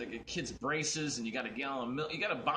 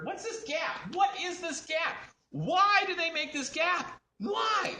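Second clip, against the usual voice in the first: high mock outrage, building louder; exasperated rant; 5 dB louder, slower than usual. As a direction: wail each question higher, ending on a loud why. Acted exasperated rant about vests.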